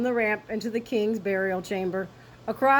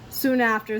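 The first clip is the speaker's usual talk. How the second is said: usual speaking voice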